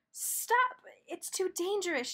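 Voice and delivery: high warning call; shout. A narrator's reading. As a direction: yell to stop them. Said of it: "shouted Todd".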